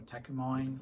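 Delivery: Takamine said "TAK-uh-mine"; alternative pronunciation. Shown as a demonstration. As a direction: end Takamine like the word "mine". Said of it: "I don't know how to pronounce it".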